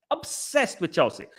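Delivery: slow, scornful repeat of "Obsessed with Chelsea"; mocking echo. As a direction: drag "Obsessed with Chelsea" out with contempt. Mocking echo of own phrase.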